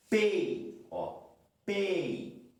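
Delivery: "Pei" with a strong E, weak i glide; falling diphthong shown. Shown as a demonstration. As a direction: hit the E in "Pei", let the i fade. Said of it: "O E é mais forte". ("Pei" com o E mais forte)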